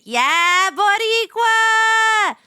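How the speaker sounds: loud, high, drawn-out holler; proud cheer. Real, not acted. Streamer hailing a fellow Boricua viewer, proud shout. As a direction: cheer it out, stretching the last word.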